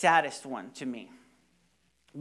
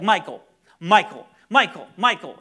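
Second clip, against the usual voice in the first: loud urgent calling of a name; shouting a name to break through; calling his name; 10 dB louder than usual. Retold or imitated. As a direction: call him back to you, loud and urgent. Calling out to a panicking boy.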